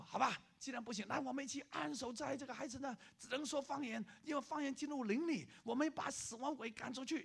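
high, eager call to pray together; rally the group to cast out death; urgent disciple. Retold or imitated. Disciple urging group prayer.

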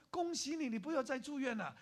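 high, loud burst of good news; excited congratulations. Acted. Doctor congratulates the student on leaving hospital, excitedly. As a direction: proclaim it high and loud, thrilled. Doctor announces recovery to patient.

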